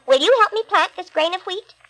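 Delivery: high eager hen voice; little red hen. Acted. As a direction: ask brightly as the little hen. Hen's request voiced high.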